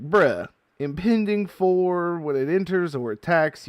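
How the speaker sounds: ordinary talk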